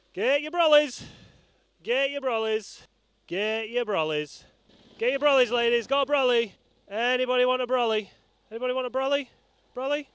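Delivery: loud, high hawker's call to the riders. Acted. Roadside presenter hawking brollies to passing riders, loud and high. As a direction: shout it out like a street vendor selling umbrellas.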